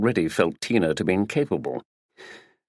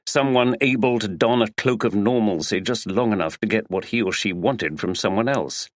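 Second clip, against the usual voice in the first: plain reading voice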